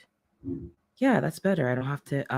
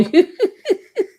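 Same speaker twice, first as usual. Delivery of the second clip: sudden high-pitched laugh; 9 dB louder than usual. Real, not acted. Sudden laugh mid-sentence.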